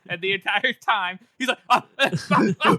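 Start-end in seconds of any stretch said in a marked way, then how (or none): 1.7-2.8 loud, higher repeated "ah" cries; comic mimicry of excited yelps; imitated excitement. Co-host teasing Jeff's excitement, imitated excited cries. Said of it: "Jeff's excitement on this chapter".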